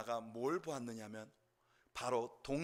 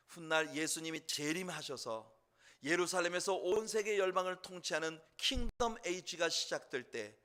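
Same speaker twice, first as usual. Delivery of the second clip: ordinary delivery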